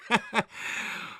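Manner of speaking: high, breathy snicker; short mocking chuckle; derisive laugh. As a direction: laugh it off scornfully. Tipsy son scoffs.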